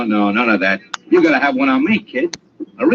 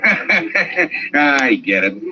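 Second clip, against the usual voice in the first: laughing, then a slow reply; knowing laugh; much slower than usual. Acted. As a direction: chuckle knowingly into the words. Laughs at the friend's excuse.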